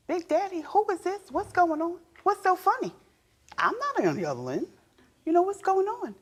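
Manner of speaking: suspicious wife. Retold or imitated. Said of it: "I'm thinking to myself".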